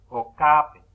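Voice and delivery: okape with loud stress on the A, not the final E; stress before final vowel. Shown as a demonstration. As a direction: punch the middle syllable of okape. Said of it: "La vocal no está al final". (okape con la a fuerte, antes de la e final)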